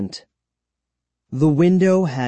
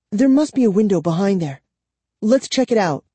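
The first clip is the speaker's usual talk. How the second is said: excited; 8 semitones higher, faster than usual. Acted. Kara excitedly finds a window.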